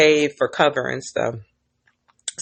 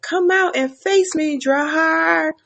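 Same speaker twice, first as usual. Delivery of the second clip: mock challenge; 12 semitones higher than usual. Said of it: "And Daemon's like".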